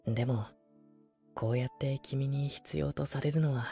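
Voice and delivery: soft tender voice close by; pillow talk. Acted. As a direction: murmur lovingly to soothe to sleep. Sleeping beside listener, tender.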